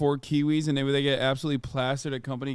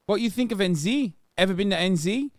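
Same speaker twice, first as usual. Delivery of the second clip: mock New Zealand accent, higher; Kiwi accent; 5 semitones higher than usual. Streamer mocking a Kiwi chatter, in a Kiwi accent. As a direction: tease in a Kiwi voice, asking about NZ.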